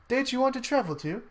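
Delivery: high, quick, lightly voiced menu prompt; questioning machine prompt. Time machine prompts the traveler again, lightly voiced question. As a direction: pipe up with a quick rising question.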